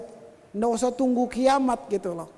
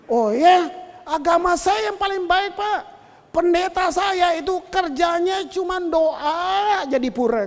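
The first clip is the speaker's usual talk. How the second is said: lightly acted, insistent higher-pitched boast; boastful taxi driver; 6 semitones higher, 5 dB louder than usual. Taxi driver telling the pastor his religion is best, mock boasting. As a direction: press the claim emphatically, as a retold driver.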